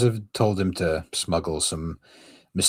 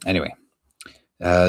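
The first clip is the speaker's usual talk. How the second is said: ordinary delivery, nothing marked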